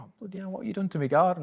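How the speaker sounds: imitating his startled outburst; shocked. Father-in-law seeing his changed garden, mock shocked voice.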